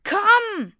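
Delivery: high, loud urgent call; father's frantic shout. A narrator's reading. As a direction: yell at the boy to hurry. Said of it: "rief mein Vater".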